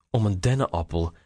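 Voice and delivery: a touch of mirth, held back; mild amused disbelief. A narrator's reading. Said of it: "Eduard proestte het uit". (licht geamuseerd, ingehouden)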